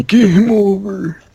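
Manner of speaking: high, loud, drawn-out joke wail; mock despair. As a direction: stretch it out high in pretend doom. Echoing the mock doom.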